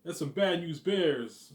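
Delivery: lightly imitating Brad, slow; Brad impression. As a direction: drag it out mockingly. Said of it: "I'm saying".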